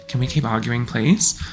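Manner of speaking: ordinary delivery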